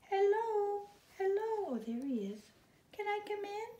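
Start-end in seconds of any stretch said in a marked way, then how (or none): 0.1-1.7 high, small birdlike voice; a tiny high chirping baby bird; little bird voice. Acted. 2.9-3.7 high, small birdlike voice; a tiny high chirping baby bird; little bird voice. Acted.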